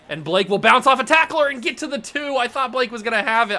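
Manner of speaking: live excited play-by-play; excited call. Real, not acted. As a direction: call the run with rising hype.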